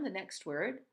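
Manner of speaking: ordinary talk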